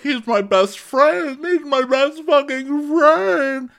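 high, loud, drawn-out wail; hammed-up wailing over a best friend; mock breakdown. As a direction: stretch it out high like a melodramatic breakdown. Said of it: "spotting that shit during a breakdown".

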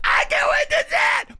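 mock fangirl squeal. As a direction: squeal it again, bigger.

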